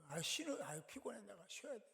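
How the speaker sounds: soft, tired self-excusing; acted worn-out inner voice; mock weariness. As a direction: grumble quietly like someone begging off. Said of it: "이런 생각 드는 거죠".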